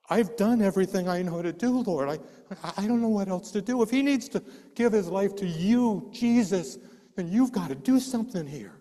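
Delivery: high, loud, pleading to God; urgent prayer. Retold or imitated.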